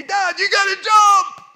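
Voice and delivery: very high, loud, slow call; mock shouted urging. Sons shout up to dad on the cliff to jump, very high and loud. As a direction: yell it up from far below.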